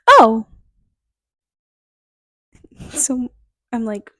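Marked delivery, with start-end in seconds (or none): 0.1-0.5 mild surprised oh. Retold or imitated. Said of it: "Hannah goes".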